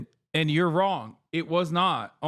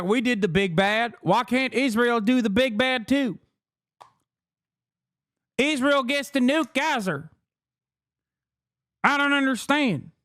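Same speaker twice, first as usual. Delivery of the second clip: mock Southern senator pleading his case; put-on Southern accent. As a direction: parody a Southern politician's accent. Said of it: "do this accent more often".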